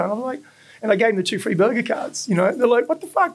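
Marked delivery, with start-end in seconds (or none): 2.9-3.3 high, squeaky what the fuck; imitating two surprised girls; mock shocked girls. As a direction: play the stunned girls, pitched way up. Girls reacting to free cards.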